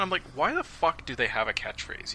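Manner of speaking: ordinary delivery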